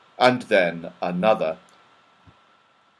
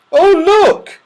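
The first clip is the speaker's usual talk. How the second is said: delighted shriek; 11 dB louder than usual. Acted. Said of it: "shrieked Beth suddenly in delight".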